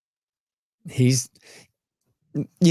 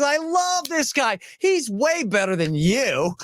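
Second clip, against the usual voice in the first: gushing boss; 13 semitones higher, slower than usual. Retold or imitated. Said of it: "He says".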